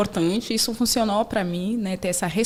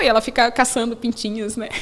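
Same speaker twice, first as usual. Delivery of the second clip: ordinary talk, nothing marked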